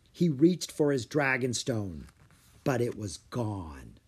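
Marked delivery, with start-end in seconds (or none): none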